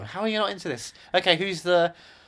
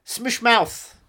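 slow, deliberate cartoon German; heavy comic German accent; mock German accent; 8 semitones higher, 9 dB louder, much slower than usual. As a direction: mangle it slowly in fake German. Continues comic German character.

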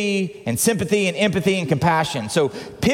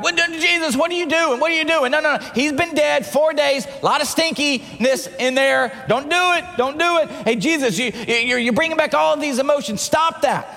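high, agitated string of objections; urgent, high-pitched pleading protest; protesting crowd member; 7 semitones higher than usual. Acted. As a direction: play an alarmed onlooker trying to stop him. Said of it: "you're the crowd".

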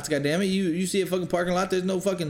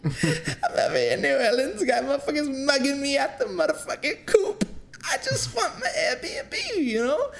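very high voice breaking into laughter; high-pitched laughing rant to his friend about the wrong address; laughing squeal; 13 semitones higher than usual. Retold or imitated. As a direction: squeak out the complaint while cracking up laughing. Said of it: "reach out to L J and was like".

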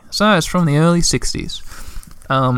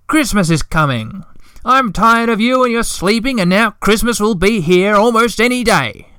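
cross shout; 7 semitones higher than usual. A narrator's reading. Hemulen storming downstairs at the sleepers, cross shout. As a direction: bark it out crossly, pitched up. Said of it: "he shouted in a cross voice".

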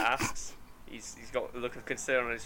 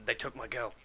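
choking back tears; 2 semitones lower, 5 dB quieter than usual. Acted. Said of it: "he's trying to choke back tears".

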